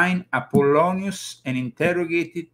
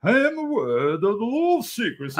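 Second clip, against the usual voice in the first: high-pitched mock English accent; hammed-up English voice, pitched way up; strongly marked English accent; 9 semitones higher than usual. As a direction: do a caricature of high English speech. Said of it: "with a strongly marked English accent".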